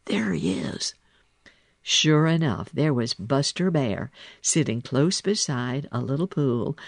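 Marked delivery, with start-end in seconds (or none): 0.1-0.9 hushed, warning whisper; shush, then whisper it; secretive whisper to a companion. A narrator's reading. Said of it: "when Billy Mink whispered".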